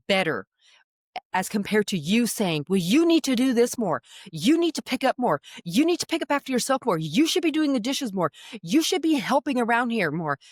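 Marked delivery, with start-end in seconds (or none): 2.7-10.4 higher, repetitive sing-through of complaints; acted parental nagging. Parent listing chores at a teen, mock nagging. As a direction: pile up each demand like a nagging parent.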